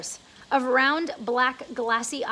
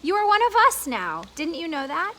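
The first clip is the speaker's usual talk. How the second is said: kind ladybug; 4 semitones higher, 4 dB louder than usual. Acted. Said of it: "the ladybug said kindly".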